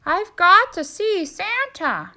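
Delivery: high, loud and pleading; desperate turkey voice. Acted. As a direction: insist on seeing Santa.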